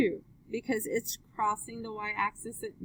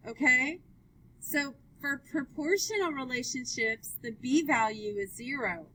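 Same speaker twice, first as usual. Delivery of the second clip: usual speaking voice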